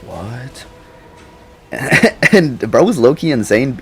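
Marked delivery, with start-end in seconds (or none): 0.1-0.6 mumbling. Retold or imitated. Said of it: "like, mumble shit".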